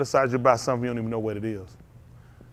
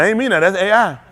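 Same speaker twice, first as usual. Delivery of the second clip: joking excuse; 7 semitones higher, 8 dB louder than usual. Retold or imitated. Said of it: "I said".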